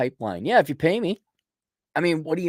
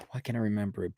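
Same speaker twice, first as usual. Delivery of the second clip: frustrated whisper; 4 semitones lower, 9 dB quieter, faster than usual. Real, not acted. Struggling to remember.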